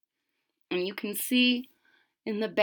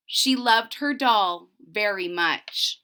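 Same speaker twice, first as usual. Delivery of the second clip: put-on slow, measured storytelling to children; story narration; 5 dB louder, slower than usual. A narrator's reading. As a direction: read it like a legend to a class. Teacher reads story aloud.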